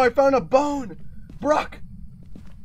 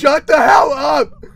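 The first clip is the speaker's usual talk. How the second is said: shouting; 5 semitones higher, 8 dB louder, slower than usual. Real, not acted. Friends yelling while gaming.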